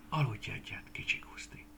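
soft, breathy goodnight whisper. Acted. Scarecrow lays Guszti in the crate, soft whisper. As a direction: tuck him in with a hush.

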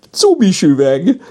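high, loud, drawn-out silly voice; tee-hee it out high and slow; giggly character voice. A narrator's reading. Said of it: "heherészett Picasso".

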